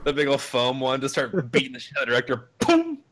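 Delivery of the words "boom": boom noise. Retold or imitated. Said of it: "he's making that noise".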